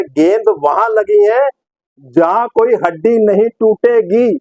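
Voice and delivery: slow, drawn-out comic punchline; Sidhu. Retold or imitated. Presenter quoting Sidhu on a groin hit, slow declamation. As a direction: space out each word, holding back the laugh. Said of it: "तो अब सिद्धू इस पे क्या बोलते हैं?".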